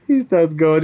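ordinary delivery

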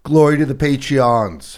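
slow, loud and lofty; mock grand proclamation. Comedian hailing the patrons, slow, lofty mock proclamation. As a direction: proclaim it like a herald. Opens patron shout-outs with flourish.

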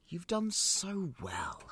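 slow, high sugary praise; butter her up; coaxing. Acted. Coaxing the silent girl.

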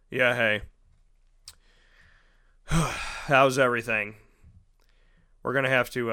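low, quiet, sighing doctor brushing him off; depressed doctor. Retold or imitated. Doctor to post-surgery patient, low and sighing. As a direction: mumble it like a tired, bored doctor with sighs. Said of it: "sounded depressed, angry, and upset".

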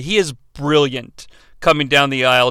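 ordinary talk, nothing marked

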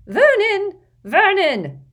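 high loud panicked call. Acted. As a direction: yell for him, very high. Petunia calls husband in panic.

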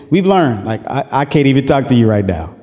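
ordinary talk, nothing marked

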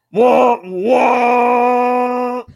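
loud blaring horn noise; imitated honking. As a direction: blast it out like a truck horn. Trucker honks at him.

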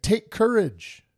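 high, drawn-out call; called-out encouragement. Retold or imitated. Jesus to frightened disciples on the lake, high rallying call. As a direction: call it out slowly to hearten them. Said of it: "he said".

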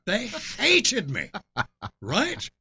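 laughing through the words. Real, not acted. Telling a friend about being booed, laughing. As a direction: laugh it out, amused at the memory.

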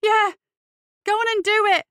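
high, loud shout; furious dare. Acted. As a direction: yell the challenge at full force. Radley goads knife-wielding bully.

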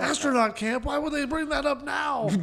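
high, loud indignant viewer shout; mock outrage. Imagined viewer reacting after the Challenger, in mock outrage. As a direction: cry out in imitated disbelief, pitch way up. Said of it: "I wonder if people were kinda like".

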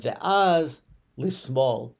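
slow, spelled-out ve'az lismol; drawn out like careful directions. Retold or imitated. Russian immigrant giving directions, slow and careful.

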